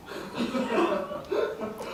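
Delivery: silent wheezing laugh; helpless airy giggle; breathless laugh. Real, not acted. Laughing at own story.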